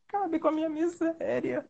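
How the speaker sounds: high, soft, slow plea to be put out of misery; mock despair. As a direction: beg half-laughing to end it all. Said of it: "Eu ficava".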